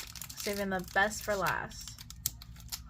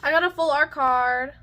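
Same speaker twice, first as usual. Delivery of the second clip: excited gasp; 5 semitones higher, 13 dB louder than usual. Real, not acted. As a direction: gasp then loud thrilled shout. Gasps at a good pull.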